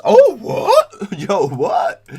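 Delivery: high squeal of surprise; surprised squeal. Real, not acted. Reacting loudly to news of cheeseburger pies. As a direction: shriek it out in shock.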